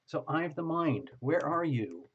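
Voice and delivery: ordinary delivery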